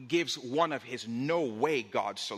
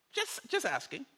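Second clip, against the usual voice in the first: innocent aside; 8 semitones higher, 3 dB quieter than usual. Real, not acted. Disarming aside after acted rant.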